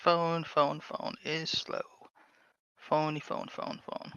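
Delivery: quietly chanting words to fill the wait; muttering to self. Real, not acted. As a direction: murmur it under your breath while waiting. Waiting on a slow phone.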